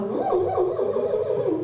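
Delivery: high sliding ooh warm-up hoots; high vocal warm-up. Retold or imitated. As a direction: hoot high oohs to warm up the voice. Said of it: "walk around home going".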